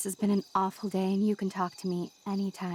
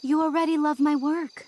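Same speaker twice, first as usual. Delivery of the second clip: pushy, higher and louder insistence; настойчивое давление; 7 semitones higher, 5 dB louder than usual. Acted. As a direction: press your case, voice raised. Student pressing teacher to pick her.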